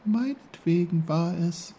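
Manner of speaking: high, small flower voice; high-pitched little character voice; little snowdrop. A narrator's reading. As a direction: speak as a tiny snowdrop. Snowdrop speaks.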